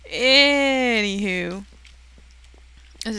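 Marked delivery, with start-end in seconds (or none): 0.1-1.6 drawn-out anywho. Acted. Drawn out moving on.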